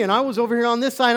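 loud, high, fervent preaching; preaching at full force. Acted. Preacher to church, crying out the inner fight loudly. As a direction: lift the whole room with it.

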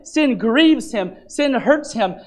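ordinary talk, nothing marked

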